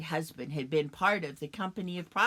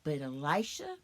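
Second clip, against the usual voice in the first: plain reading voice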